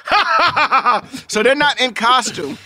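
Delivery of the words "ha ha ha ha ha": real laughter trailing off the chant; laughing. Real, not acted. Friend laughing at his own movie impression. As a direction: laugh through it.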